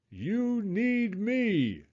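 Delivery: high, very slow eerie voice; creepy crayon voice. A narrator's reading. The crayon whispering to Jasper from the mirror, eerie and slow. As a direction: drag it out like a haunting.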